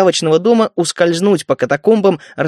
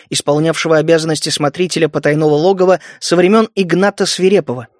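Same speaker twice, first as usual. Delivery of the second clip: plain reading voice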